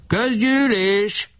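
very high, slow drawn-out screech; screeching demand. A narrator's reading. Barabás demanding a meeting, very high and slow. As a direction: stretch the word out in a shrieking cry. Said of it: "rikácsoló hangon kiabált".

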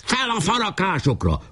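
high, urgent attack call; commander's order. A narrator's reading. Pásztor orders his troops up the woodpiles, high call. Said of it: "Pásztor újra parancsot adott".

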